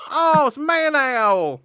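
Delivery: high, loud and drawn out; excited. Real, not acted. Player announcing Man Owl's arrival, high and loud.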